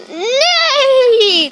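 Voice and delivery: high, falling horse whinny; horse neigh. Shown as a demonstration. Second try at the horse, high whinny. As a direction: let out one long high neigh.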